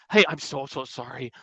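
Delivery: grovel like a wimp, so so sorry; wimpy apology. Shown as a demonstration. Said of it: "like a wimp".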